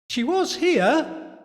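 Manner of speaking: put-on high, slow boyish reply; boy's voice. A narrator's reading. Boy answering Louise in the dark, high boyish voice. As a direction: pitch it up like a puzzled boy. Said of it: "said a boy".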